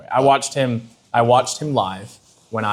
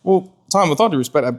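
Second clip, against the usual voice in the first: laughing mock confrontation; 6 semitones higher than usual. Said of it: "I'd be like".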